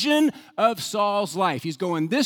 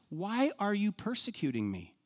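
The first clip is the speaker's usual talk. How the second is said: a much softer, gentle question; tender; 2 semitones higher, 8 dB quieter than usual. Acted. Jesus asks Saul, very soft and tender. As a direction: ask it softly, like a tender plea. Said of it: "It's so tender".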